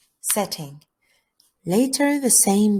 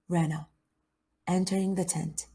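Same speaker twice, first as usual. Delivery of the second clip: ordinary delivery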